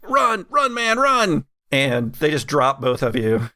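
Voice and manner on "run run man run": high-pitched, panicky henchman; acted panic, voice pitched way up; panicked. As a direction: urge a partner to flee in comic panic. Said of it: "panics a little bit and goes".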